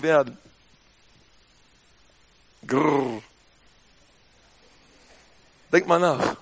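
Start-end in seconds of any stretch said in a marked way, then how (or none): 2.7-3.2 low playful growl; a short low mock snarl. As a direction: growl like an angry dog. Answering whether love can be violent.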